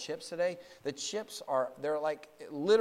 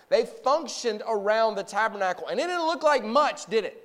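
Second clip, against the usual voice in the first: raised, higher-pitched preacher's voice; forceful preaching; 5 semitones higher, 8 dB louder than usual. Real, not acted. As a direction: preach it out to the whole congregation.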